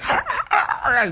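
high frantic snarling; mock yelping growl; imitated dog snarl. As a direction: snarl like a small dog trying to bite. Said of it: "the dog is just trying to bite me".

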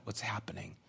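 a lost, breathless whisper. Acted. A believer asking where God went, whispered. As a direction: let the question drop to a whisper. Despairing question after bottom falls out.

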